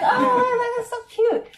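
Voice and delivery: high, loud laughing squeal over cute clothes; squealing delight. Retold or imitated. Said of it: "It's like".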